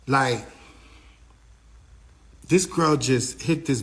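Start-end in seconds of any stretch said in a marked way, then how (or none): none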